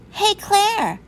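high, loud call to a friend; mean girl calling out. A narrator's reading. Mean girl calls her friend.

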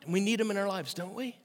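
ordinary talk

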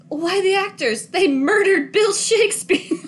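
high-pitched, hammed-up protest; playfully scandalized quote; mock outrage. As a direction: act out an aghast complaint, pitched high. Acting out favorite film line.

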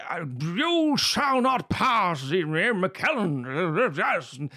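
hammed-up Shakespearean declaiming, very slow and high; booming mock wizard, drawn out; Ian McKellen. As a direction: roll and stretch it like a grand thespian. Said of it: "literal thespians and Shakespearean actors".

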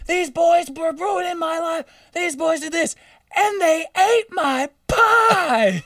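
put-on slow, high, shrieking old-lady voice; screaming old lady. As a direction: screech it out the front door, stretching the words. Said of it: "screaming out the front door".

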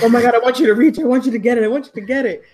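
mock-agonized egging on of a contestant; agonized cheering. Imitating mom rooting for TV contestants, in mock agony. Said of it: "screams for them in, like, agony".

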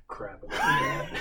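laughing through the correction. Real, not acted. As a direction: giggle as you fix the swear.

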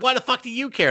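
high-pitched mock outrage; retold indignation. Retold indignant comeback, repeated.

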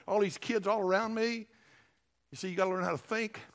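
ordinary talk

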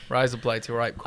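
easygoing fake Aussie patter; mock Australian accent. Showing off an accent to friends, mock Australian accent. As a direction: run the phrases together in put-on Australian. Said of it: "Everyone do their best Australian accent".

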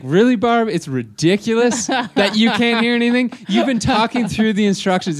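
high, loud exasperated disbelief; incredulous retort. Retold or imitated. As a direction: throw her complaint back, incredulous. Incredulous retort to Barb's complaint.

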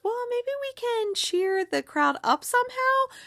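high sing-out character voice; chirpy suggestion. Retold or imitated. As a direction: pitch it way up, imitating a hopeful suggestion. Chirpy suggestion in acted quote.

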